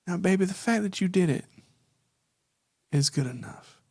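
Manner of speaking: soft, tender mother's voice; warm mom tone. Acted. Spider mother comforting her daughter, soft and tender. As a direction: reassure your child gently. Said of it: "that warm tone that your mom talked to you with".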